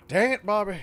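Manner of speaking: lightly voiced cartoon-dad exasperation; a hint of catchphrase impression; Hank Hill. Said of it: "do a better Hank Hill impersonation".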